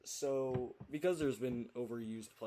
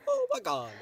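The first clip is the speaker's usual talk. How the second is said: Mario suddenly in a normal voice, a bit higher and louder; Mario dropping accent; 8 dB louder than usual. Acted. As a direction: blurt it like you forgot the accent. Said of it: "that's Mario kind of forgetting his accent".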